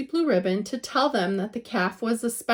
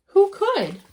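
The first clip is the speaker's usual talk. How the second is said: despairing cry; 9 semitones higher, 6 dB louder than usual. A narrator's reading. Despairing rhetorical question.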